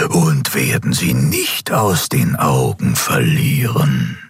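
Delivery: low, very slow dark-ruler voice; menacing emperor. A narrator's reading. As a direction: draw out each word like a shadow emperor.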